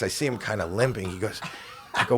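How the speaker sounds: ordinary talk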